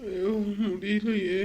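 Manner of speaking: slow, soft, feverish moan; sick and weak. Acted. Husband lying sick with fever, groaning weakly. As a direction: drag the words out like you're ill with fever.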